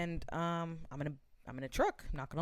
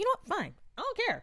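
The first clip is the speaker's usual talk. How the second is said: much higher, fed-up retort; exasperated; faster than usual. Retold or imitated. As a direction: toss it off, totally fed up. She gives up, exasperated.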